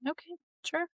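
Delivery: high, soft okay sure whatever; dismissive. Real, not acted. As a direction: brush it off, amused. Dismissing a silly detail.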